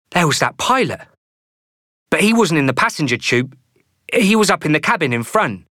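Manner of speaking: put-on a nervous boy piecing things together; plump anxious boy. Fat boy character voice.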